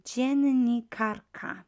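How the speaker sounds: dziennikarka said very slowly and loud; slow model word. Shown as a demonstration. Teacher modelling dziennikarka for learners. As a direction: drawl out dziennikarka syllable by syllable. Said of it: "So dziennikarz".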